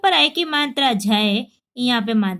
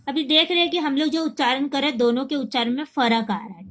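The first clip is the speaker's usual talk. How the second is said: usual speaking voice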